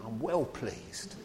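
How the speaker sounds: slow, light Cockney take on God's words; lightly voiced Cockney, drawing out "well pleased"; cockney accent. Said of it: "Makes God sound like a cockney".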